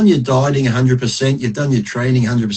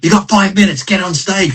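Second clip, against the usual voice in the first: high-pitched backstage rush call; mock-urgent call to the performers; imitated urgent announcement; 7 semitones higher than usual. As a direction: act out someone running in to hurry everyone along. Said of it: "they come running back".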